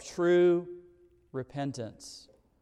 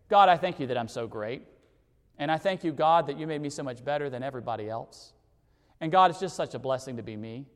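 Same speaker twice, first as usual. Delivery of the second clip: lower, faster, a hint of a self-satisfied prig; mock smug Pharisee; 6 semitones lower, faster than usual. Preacher mocking the Pharisee's boastful prayer, smug and quick. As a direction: lightly send up the Pharisee praising himself. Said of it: "That's the [name] International Version".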